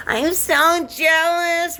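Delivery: mocking whine. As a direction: whine it like a jealous brat. Mocking jealous people.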